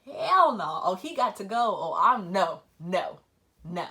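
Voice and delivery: fed up. Retold or imitated. Quoting herself rejecting the immature person, loud and fed up. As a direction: brush him off, done with it. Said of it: "I'm like".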